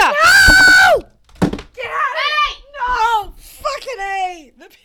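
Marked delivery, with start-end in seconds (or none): 0.0-3.3 panicked shouting. Real, not acted. Stopping the kid. 3.4-4.5 slow, higher cry of dismay; anguished wail. Real, not acted. Pizza lost.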